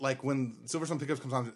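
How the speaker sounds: usual speaking voice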